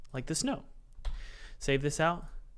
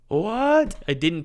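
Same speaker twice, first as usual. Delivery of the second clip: surprised; 6 semitones higher, 8 dB louder, slower than usual. Real, not acted.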